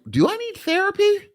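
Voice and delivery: high-pitched wondering self-question. Retold or imitated. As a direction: squeak it out, unsure. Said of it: "being like".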